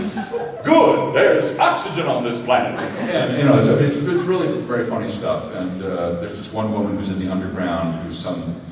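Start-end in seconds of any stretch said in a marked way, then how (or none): 0.6-2.8 hammed-up delighted announcement, high start; playing the professor stepping out; mock professor. Retelling a film, a hammed-up professor voice.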